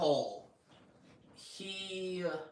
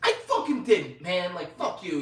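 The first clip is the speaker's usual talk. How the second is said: loud, high mock outburst that eases off; indignant denial; 4 semitones higher, 6 dB louder than usual. Accused knight answering his commander back, indignant. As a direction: imitate a defiant comeback. Acted denial in retold quarrel.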